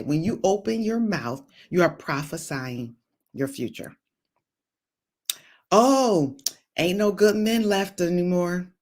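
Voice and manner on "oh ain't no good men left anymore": whiny put-on complaining voice; imitating a jaded single woman moaning; mock complaint. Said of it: "you just prophesied your future".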